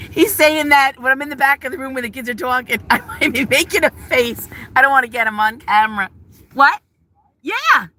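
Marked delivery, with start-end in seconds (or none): none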